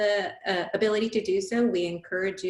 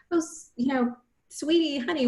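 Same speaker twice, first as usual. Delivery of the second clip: tender; 6 semitones higher than usual. Shown as a demonstration. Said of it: "it's really saying".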